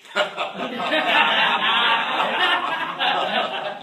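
laughs out loud at himself; big burst of laughter; real loud laugh. Real, not acted. Speaker to audience, laughing loudly at the ribbing.

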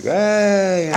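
high, bright mock greeting hollered up; cheery call. Arriving at the club, he greets the old-timers with a high cheery call. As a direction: sing out a hey to get their attention.

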